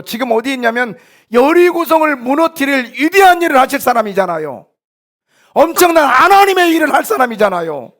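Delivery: loud, high-pitched pulpit exhortation; impassioned preaching. Real, not acted. Pastor telling believers who they are, impassioned preaching. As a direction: cry it out to rouse the congregation.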